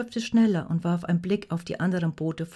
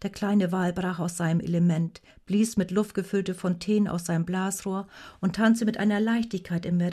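plain reading voice

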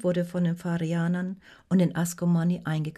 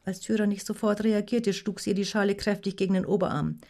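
plain reading voice

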